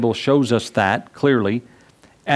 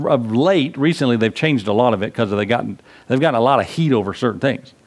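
ordinary talk, nothing marked

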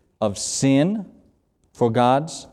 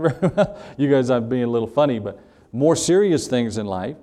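ordinary delivery

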